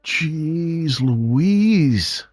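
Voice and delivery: ordinary delivery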